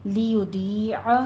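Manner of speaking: لِيُضِيعَ slow, light lam and a drawn ya; recitation demo. Shown as a demonstration. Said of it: "لام کی آواز باریک رکھیے".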